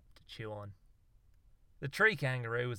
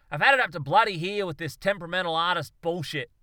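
loud, higher fed-up scolding; angry rant; 6 semitones higher, 8 dB louder than usual. Acted. Darwin scolds his temperamental monkeys, angry and loud. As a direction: tell them off at full volume.

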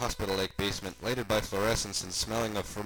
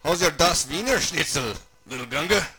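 raised, loud cartoon uncle; louder, higher storybook character; Uncle Creepy character voice; 6 semitones higher, 7 dB louder than usual. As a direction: play a hearty oddball uncle, pitched up.

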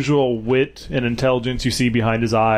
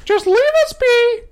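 high, loud desperate pleading; 5 dB louder than usual. Acted. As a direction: beg high and strained to spare the kids. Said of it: "he'll just say".

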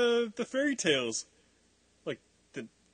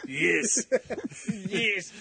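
ordinary delivery

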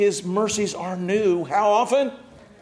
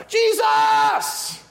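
much higher shouted Jesus; louder cry. Acted. Blind Bartimaeus shouting for Jesus, very high. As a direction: yell it over everyone. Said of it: "He cried louder".

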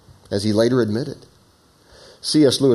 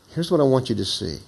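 ordinary talk

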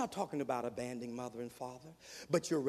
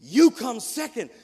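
loud declaration after a long pause; thundering punchline; 7 semitones higher, 15 dB louder than usual. Real, not acted. Preacher hammering the point home to the student. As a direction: hit the verdict hard.

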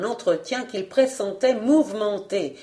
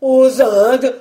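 loud, raised cry of mock horror; loud, higher, appalled question; feigned terror; 4 semitones higher, 9 dB louder than usual. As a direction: cry out in put-on fright. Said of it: "en feignant une subite épouvante".

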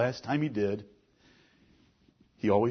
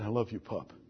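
soft, much quieter loving words to a son; tender; 8 dB quieter than usual. Retold or imitated. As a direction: murmur it gently like to a boy in bed. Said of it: "I said".

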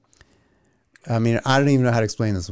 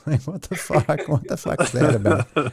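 laughing disbelief. Real, not acted. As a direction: laugh through the words at himself. Laughing at his own habit.

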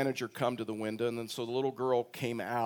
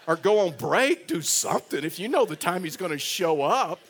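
higher and louder, laughing through the words; laughing advice; 4 semitones higher, 6 dB louder than usual. Retold or imitated. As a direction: chuckle while giving cheeky advice.

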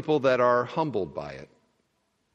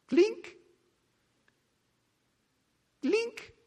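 high, thin clink, clink; tiny coin clink; 4 dB quieter than usual. Retold or imitated. Preacher imitating the widow's two mites: high tiny clink. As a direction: make two little coins tinkle, very high.

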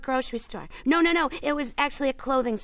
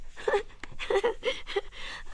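laughing; 8 semitones higher, 7 dB quieter than usual. Real, not acted. Laughs at her own joke.

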